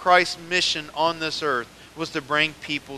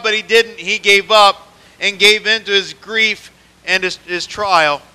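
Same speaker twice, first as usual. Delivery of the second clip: raised, driving preaching; firm pulpit delivery, building; 6 semitones higher, 10 dB louder than usual. Acted. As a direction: push the point home to the church. Preacher rises again.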